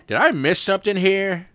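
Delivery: wondering aloud if he lost the thread; baffled. Real, not acted. Confused by the book's twist.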